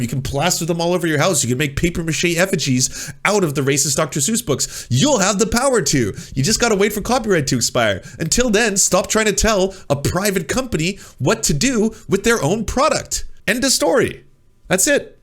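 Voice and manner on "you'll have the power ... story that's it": worked-up rant ending in a high exasperated End of story; building rant. Real, not acted. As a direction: pitch climbs higher to an exasperated peak. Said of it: "rising rant to the end".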